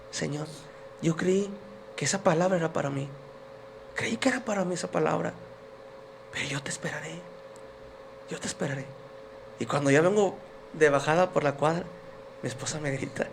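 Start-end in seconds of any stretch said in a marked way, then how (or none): 0.1-8.8 much softer, humble plea to the Lord; hushed prayer. Retold or imitated. Man under the stairs praying to God for his wife, hushed. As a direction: pray it quietly under your breath.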